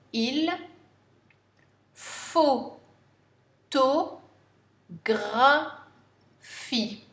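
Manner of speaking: photographie stretched out very slowly, each syllable held; very slow dictation. Shown as a demonstration. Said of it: "N'oubliez pas le E muet".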